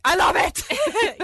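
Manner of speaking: high, loud, slow gush; gushing delight. Retold or imitated. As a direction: stretch it out in delight. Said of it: "I'm like".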